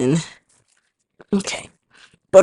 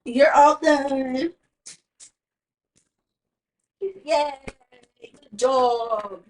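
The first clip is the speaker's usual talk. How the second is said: warm praise; 3 semitones higher, 5 dB louder, much slower than usual. Real, not acted. Mother praising her baby, slow and warm. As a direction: tell the little one he's finished.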